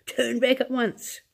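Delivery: high, slowed frightened granny voice; old grandma begging in alarm; frightened old woman. A narrator's reading. Old grandma pleading with Wonka, high and frightened.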